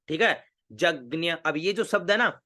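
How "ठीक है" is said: ordinary talk, nothing marked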